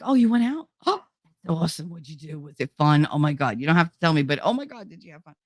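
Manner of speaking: fast, gasping, gushing friend; gushing excitement. Retold or imitated. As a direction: burst with delight for her. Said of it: "they're very like".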